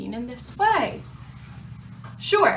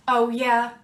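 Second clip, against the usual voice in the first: usual speaking voice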